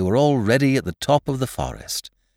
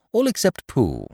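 usual speaking voice

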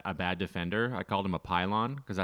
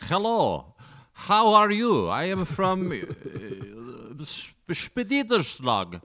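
put-on high, loud foreign accent; hammed-up Eastern foreigner accent, pitched way up; mock foreign accent; 9 semitones higher, 6 dB louder, much slower than usual. Co-host mocked as Stan-country newcomer, hammed-up foreign accent. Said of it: "That's you, but with this accent".

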